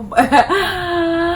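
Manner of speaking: high, loud burst of laughter. Real, not acted. Breaks off laughing mid-story.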